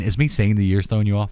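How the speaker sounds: normal talking voice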